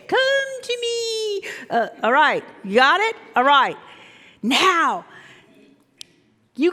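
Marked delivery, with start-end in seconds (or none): 0.1-1.5 slow, high beckoning; slow high siren call; luring call. Acted. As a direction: draw them in slowly, high and sweet. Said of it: "acted dialogue".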